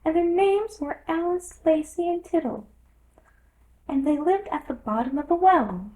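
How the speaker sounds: high, squeaky storyteller mouse; a sleepy little creature spinning a tale; Dormouse voice. A narrator's reading. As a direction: Dormouse telling Alice about the sisters.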